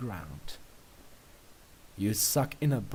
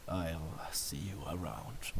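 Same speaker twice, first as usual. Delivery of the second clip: lower, softer, slow sulky goodbye; mock dejected; 8 semitones lower, 11 dB quieter, slower than usual. A narrator's reading. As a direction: fake disappointment as you leave. Mock-sad exit.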